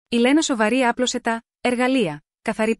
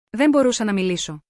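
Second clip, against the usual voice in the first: plain reading voice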